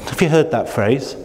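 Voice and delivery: normal talking voice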